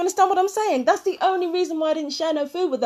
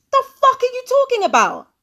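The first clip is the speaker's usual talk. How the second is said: outraged shout; 9 semitones higher than usual. Real, not acted. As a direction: throw the question back in disbelief. Outraged at played clip.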